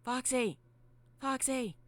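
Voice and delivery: high, loud call; high character voice shouting for someone. Acted. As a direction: call out a name high and urgent. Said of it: "As she's shouting out for Foxy".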